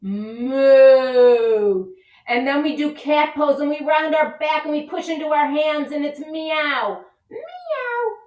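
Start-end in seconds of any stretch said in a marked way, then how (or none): 0.0-1.9 loud playful moo; cow moo. Pretend. Yoga teacher mooing in cow pose for kids. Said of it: "I like to moo and meow". 6.2-8.1 cat meow. Pretend. Teacher meowing in cat pose for kids. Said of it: "I like to moo and meow".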